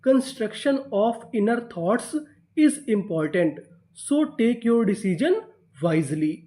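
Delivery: very slow, word by word; teacher reading the full sentence at dictation pace; slow reading aloud. Shown as a demonstration. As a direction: read the sentence slowly for the class. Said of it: "तो पूरा sentence क्या बना?".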